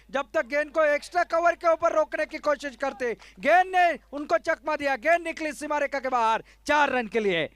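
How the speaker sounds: rising to a loud call of the four; excited cricket commentary. Real, not acted. Boundary four in live commentary.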